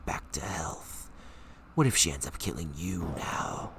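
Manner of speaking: low, slow, menacing whisper; sinister inner voice. Acted. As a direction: hiss it like the evil voice in your head. Reads the dark inner voice.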